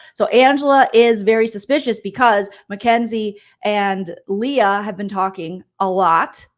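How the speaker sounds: ordinary talk, nothing marked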